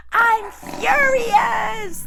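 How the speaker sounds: furious shout. Acted. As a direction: tiger roaring his fury. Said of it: "the tiger shouted".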